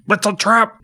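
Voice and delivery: high, loud alarmed cry; Admiral Ackbar shout. Retold or imitated. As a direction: yell the warning like Ackbar on the bridge. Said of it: "Admiral Ackbar says the famous line".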